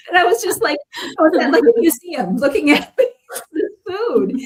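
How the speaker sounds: amused, laughing through the story; laughing while talking. Real, not acted. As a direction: keep laughing as you tell it.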